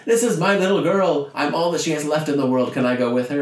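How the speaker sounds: high, loud pleading act; hammed-up fake father. Billy Zane begs the officer for a seat, high pleading. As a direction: overplay the desperate dad.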